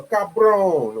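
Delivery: a hint of a mocking Spanish accent. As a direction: sneer out "cabron" in a put-on Spanish sound. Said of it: "whatever Spanish words they even say".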